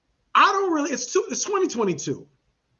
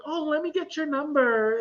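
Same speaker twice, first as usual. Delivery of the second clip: mimicking the guy; 6 semitones higher than usual. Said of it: "is that what he sounded like?".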